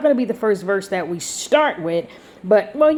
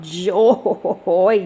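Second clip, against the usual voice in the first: joyful chuckle breaking up the word; laughing with delight. Real, not acted. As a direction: laugh right through the word. Laughs through the word joy.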